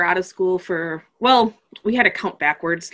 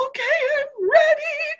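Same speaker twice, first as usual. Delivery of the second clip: put-on much higher, tearful small-girl voice; a scared kid bracing herself; high imitated child, half crying; teary little girl. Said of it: "But then she goes".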